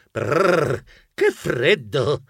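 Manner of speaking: slow, teeth-chattering brrr; shivering from cold. Acted. Mouse stepping into freezing wind, shivering. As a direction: shiver the words out.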